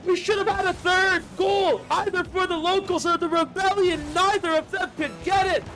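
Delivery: fast, high, energetic commentary; live play-by-play. Real, not acted. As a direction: call the match live.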